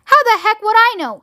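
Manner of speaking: high-pitched, exasperated retort. A narrator's reading. Ground control retorting to Shanks, exasperated. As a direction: throw the question back irritably.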